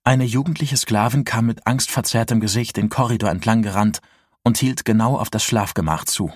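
usual speaking voice

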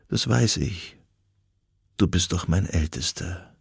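murmured quietly, just under the narration; soft murmur. A narrator's reading. Dying mother to her eldest at the sickbed, soft murmur. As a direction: murmur it softly, with little force. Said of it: "murmelte sie".

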